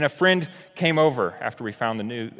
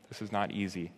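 quiet repeat; 3 semitones lower, 7 dB quieter than usual. Real, not acted. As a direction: repeat "not easy" much softer, sinking. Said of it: "this, this is not easy".

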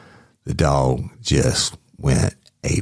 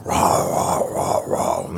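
low, rough mock barks; imitated dog barking; 8 semitones lower, much slower than usual. Storyteller imitating the dog trying to get to him, gruff barking. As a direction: growl out barks like a lunging dog.

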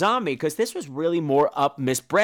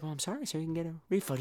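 much softer, timid apology; soft, cowed stage manager; meek apology; 12 dB quieter, much faster than usual. Retold or imitated. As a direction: shrink back and apologize quietly. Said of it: "She's like".

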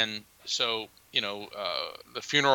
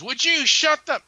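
much higher, louder mock snap; imitating angry brother; 5 dB louder than usual. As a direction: cut him off in an irritated retold outburst. Upset brother snapping back.

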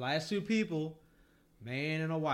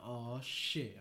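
low, soft murmur of dread; real quiet; 5 semitones lower, 7 dB quieter than usual. Retold or imitated. Passengers looking around as trouble starts, low and hushed. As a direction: imitate onlookers muttering under their breath. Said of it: "everybody just get real quiet".

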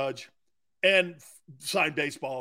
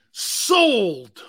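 loud, long "sold" falling steeply; big drawn-out auctioneer's hammer call; emphatic auctioneer call; 3 semitones higher, 6 dB louder than usual. Acted. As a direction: belt out the final sale. Auctioneer closes the sale again.

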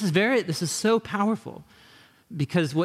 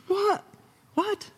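high squeaked outraged what; scandalized teacher of the law, very high; shocked scribe. Acted. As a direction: gasp it out in high disbelief. Scribes' shocked inner reaction.

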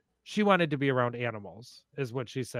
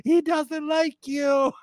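much higher, louder, slow teasing tone; amused grandma; 11 semitones higher, 4 dB louder, slower than usual. Retold or imitated. Grandma laughs as bird chases narrator, high teasing. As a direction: tease her while laughing. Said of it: "She, laughs".

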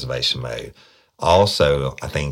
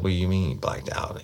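higher, quick challenge; retold incredulity; 5 semitones higher, faster than usual. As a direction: question it in mock disbelief. Retold detective challenging suspect's excuse.

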